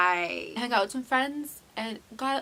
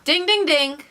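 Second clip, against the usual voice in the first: loud, high ding-ding-ding; mock bell dings; 8 semitones higher, 9 dB louder, slower than usual. Flagging her next slip to viewers, mock bell. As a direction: ring out like a game-show bell.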